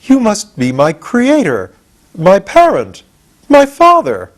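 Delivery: slow wonder that builds with each word; growing surprise. A narrator's reading. Pumpkinhead discovering Tip is his father, amazed and rising. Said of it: "in a tone that expressed surprise".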